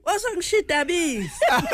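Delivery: high, drawn-out mock shout from the toilet; imitating child calling. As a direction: sing out for granny like a kid, slow and high. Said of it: "abe seyangibiza".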